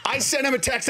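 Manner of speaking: usual speaking voice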